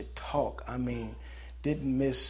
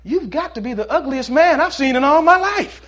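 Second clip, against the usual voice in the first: high, loud, cheeky parrot insult; loud bird insult; 11 semitones higher, 17 dB louder than usual. Acted. Parrot insulting a passing ugly man, loud and cheeky. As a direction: belt out the insult like a rude parrot.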